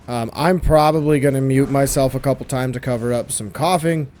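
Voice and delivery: ordinary delivery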